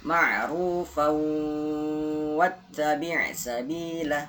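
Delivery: slow Quranic recitation, "fau" in "Ma'rufau" drawn out long; held recitation. Shown as a demonstration. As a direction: hold the "fau" of "Ma'rufau" before gliding into "wattabi'". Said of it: "di ma'rufaunya itu ditahan".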